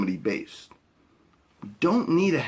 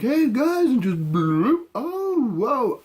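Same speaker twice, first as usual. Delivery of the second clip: dopey guy voice; 9 semitones higher, much slower than usual. Retold or imitated. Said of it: "he's just like".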